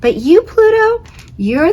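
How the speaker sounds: single him out with affection; fond call to Pluto. Acted. Sun addresses Pluto.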